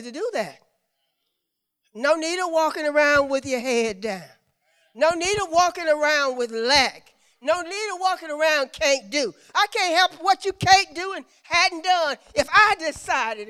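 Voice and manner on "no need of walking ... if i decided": raised, driving preacher's cadence; preaching. Acted. Preacher urges congregation off "can't do", preaching with drive. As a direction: drive home each repeated phrase.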